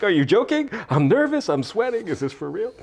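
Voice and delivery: hammed-up panic, way up high; mock shock. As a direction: play your past self, startled and nervous. Retelling own shocked reaction.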